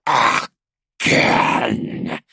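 loud, harsh creature rasp; monstrous hiss. A narrator's reading. Traitor to the captain after a burn, monstrous hiss. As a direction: hiss it forcefully like a wounded monster. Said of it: "it hissed at him".

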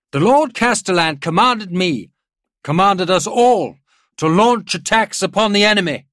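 very loud, high-pitched war oratory; rousing proclamation. A narrator's reading. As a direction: ring it out over the whole army. General rallying his officers.

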